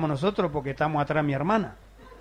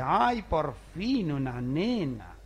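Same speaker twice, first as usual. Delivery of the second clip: drawn-out, very slow sigh of relief; relieved father; much slower than usual. Retold or imitated. Father at the hospital, slow relieved sigh. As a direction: stretch it out, savoring the long-awaited girl. Father happy at finally a girl.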